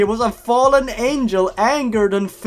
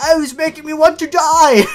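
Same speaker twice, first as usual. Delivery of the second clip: high, loud hammed-up wail; mock despair; 10 semitones higher, 8 dB louder than usual. Player faces the final boss, in mock agony. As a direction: wail it at full volume.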